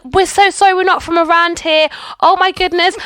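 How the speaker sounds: high, pleading acted apology; retold fluster, voice lifted high. Apologising to the bus driver.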